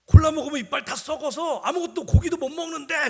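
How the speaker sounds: put-on high, loud little-boy voice; earnest five-year-old warning; child's voice. Five-year-old son warns the kids, high loud child voice. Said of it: "우리 애가 딱 이러는 거예요".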